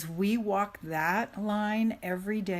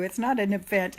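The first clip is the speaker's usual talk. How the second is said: normal talking voice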